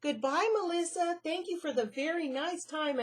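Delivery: high, loud hurried goodbye; polite farewell. Acted. Annie thanking Melissa on the way out. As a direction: thank her in a rush.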